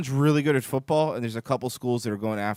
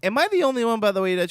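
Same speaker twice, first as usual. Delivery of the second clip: mock outrage; 8 semitones higher, 5 dB louder, faster than usual. Mock outraged rant opener.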